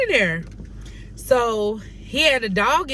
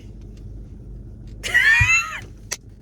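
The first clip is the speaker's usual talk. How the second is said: a breathless, much higher shriek of laughter. Real, not acted. Laughing at her own pretend apology to Papa, shrieking with laughter. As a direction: crack up at your own fake apology.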